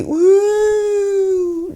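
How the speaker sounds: high, loud siren "woo"; one long slow siren wail; imitated police siren. Cop cars slowly following the goat, imitated siren. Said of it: "three other cop cars slowly".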